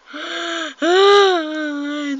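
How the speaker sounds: high, loud drawn-out gasp; long dramatic gasp. Real, not acted. Player after putting self down, long tearful gasp.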